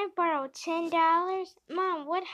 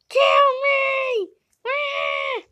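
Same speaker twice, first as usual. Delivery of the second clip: shout; 11 semitones higher, 9 dB louder, slower than usual. Acted. Dramatic death cry.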